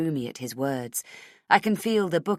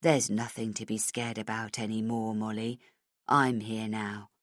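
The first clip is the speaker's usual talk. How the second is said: reassuring; 7 semitones lower, 5 dB quieter than usual. Acted. Chief comforting frightened child, low and soft. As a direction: soothe a scared child.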